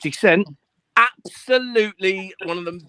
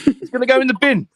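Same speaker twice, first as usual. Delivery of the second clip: louder and higher, nearly a yell; shouted repeat; 9 semitones higher, 6 dB louder than usual. Retold or imitated. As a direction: blurt it out the second time, raising the voice. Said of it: "repeats the line louder".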